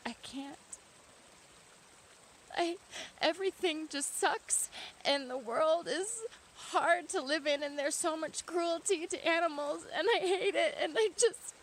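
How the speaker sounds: high, loud mock-tearful wail; depressed, near tears. Shown as a demonstration. Showing viewers how depressed people sound, hammed-up tearful despair. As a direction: act out working yourself up to cry. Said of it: "you change your tone of voice".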